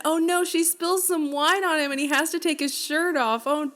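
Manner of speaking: mock dismay. Co-host mocking the contrived wine spill, mock dismay. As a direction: feign dismay at an all-too-convenient accident.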